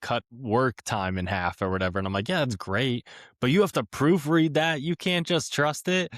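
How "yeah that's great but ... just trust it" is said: high-pitched, emphatic mock plea; insistent warning. Telling lawyers who use AI as shortcut, insistent warning. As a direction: plead the obvious in a raised pitch.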